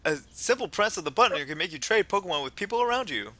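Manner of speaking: lively, exclaiming science fan; enthusiastic game character. Acted. Townsperson marvels at science, enthusiastic character voice. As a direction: proclaim it like a keen game character.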